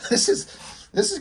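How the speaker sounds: laughing through the words; can't keep a straight face; giggling at the edgy dialogue. Real, not acted. Laughing at an offensive comic.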